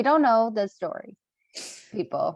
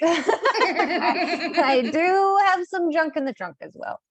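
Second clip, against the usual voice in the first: laughing; 8 semitones higher, 4 dB louder, slower than usual. Real, not acted. Laughing at butt compliment.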